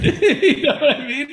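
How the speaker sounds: high, loud words through laughter; helpless giggle breaking into the question; laughing. Real, not acted. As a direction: crack up while talking. Laughing at own joke.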